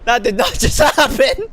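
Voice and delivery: laughing in disbelief; shocked laughter. Real, not acted. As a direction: laugh through the words, stunned.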